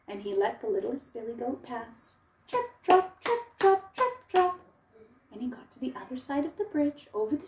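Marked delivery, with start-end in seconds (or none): 2.5-4.6 light little hoofsteps. Acted. Littlest goat crossing again.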